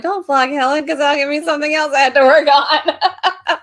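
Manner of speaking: high, loud mock protest; imitating Hannah. Host mimics her co-host objecting to another vlog, mock protest. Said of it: "Hannah's like".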